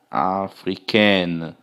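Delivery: "africaine" very slow, ending in -ken sound; teacher stretches the word for the class; feminine ending shown. Shown as a demonstration. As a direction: drag out "africaine" to show the pronounced n. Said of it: "Aqui já é diferente".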